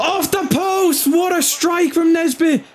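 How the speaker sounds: high, drawn-out commentator shout; excited shout. Real, not acted. Gamer to himself as striker hits post, excited shout. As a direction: cry out as the shot strikes the post. Player nearly scores in game.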